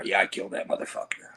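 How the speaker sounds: whispered boast, soft and low; imitated whisper. Imitating Sabu bragging to her, in a whisper. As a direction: breathe it like a proud secret. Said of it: "Sabu would be telling her".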